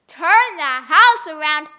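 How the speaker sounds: loud slow echo of the request; loud, slow, astonished question; surprised. Acted. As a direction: repeat it in loud disbelief. Una surprised repeat.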